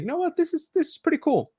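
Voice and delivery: high, thrilled inner voice; excited. Retold or imitated. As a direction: squeal it with delight. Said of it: "I was like".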